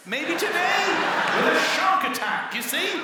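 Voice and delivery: high, loud frightened rant; shouting panic. Retold or imitated. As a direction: yell it in panic. Panicked argument about sharks.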